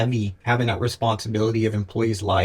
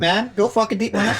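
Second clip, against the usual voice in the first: excited; 10 semitones higher than usual. Real, not acted. Hyping friend from ice bath.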